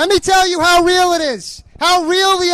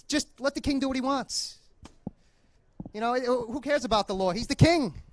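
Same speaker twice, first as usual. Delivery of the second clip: lightly voiced young man; 5 semitones lower, 12 dB quieter than usual. Voicing young man in play.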